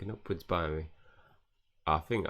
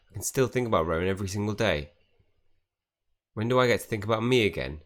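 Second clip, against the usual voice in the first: loud, insistent voice; indignant outburst; 5 dB louder than usual. Acted. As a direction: argue your case forcefully. Reads out an indignant game character's rant.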